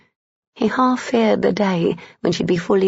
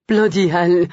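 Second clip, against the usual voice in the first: gasped out, a bit louder; 4 dB louder than usual. Acted. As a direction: gasp it out in pleasure. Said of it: "he gasped".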